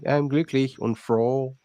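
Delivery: put-on "I'm glücklich und froh" slow, with an English accent on "froh". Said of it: "They say".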